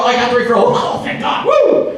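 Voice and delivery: relief turning into a sudden high whooping cheer; mock relieved cheer. Mocking agents who celebrate one referral, ending in a whoop. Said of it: "they go".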